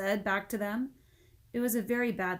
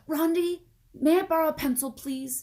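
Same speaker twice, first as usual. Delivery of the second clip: higher, louder asking; boy begging a pencil; pleading boy; 8 semitones higher, 9 dB louder than usual. Acted. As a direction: ask sweetly but urgently.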